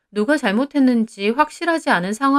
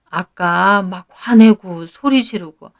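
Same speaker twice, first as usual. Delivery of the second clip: slow, emphatic mother's voice; worried mother; 2 semitones lower, 6 dB louder, slower than usual. A narrator's reading. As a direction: spell out your concern to your daughter, slowly. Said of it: "엄마는 고민 가득한 얼굴로". (걱정스럽게 천천히 짚어 주는 엄마 말투)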